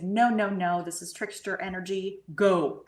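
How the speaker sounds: loud, emphatic refusal with a punched final word; forceful retold dismissal; retold firm banishing. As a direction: order it away, loud on the first and last words.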